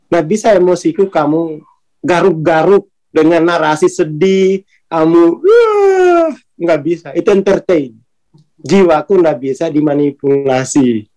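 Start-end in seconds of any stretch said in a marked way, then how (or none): 5.4-6.4 sudden high gasp of fake emotion; mock awe. Mocking a tearjerker audience with a high fake gasp. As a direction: gush like a sappy show moved you. Mock being moved by sad narration.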